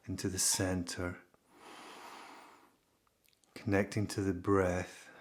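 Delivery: calm, unhurried delivery with long pauses; guided meditation. Real, not acted.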